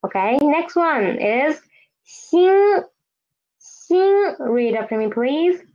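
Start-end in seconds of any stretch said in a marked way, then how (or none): 2.1-4.3 xin held high and level; sing-steady high pitch on xin, no fall; first tone. Shown as a demonstration. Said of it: "the tone is the first tone".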